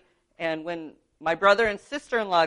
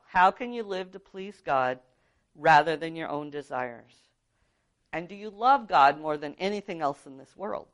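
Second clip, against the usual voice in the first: ordinary talk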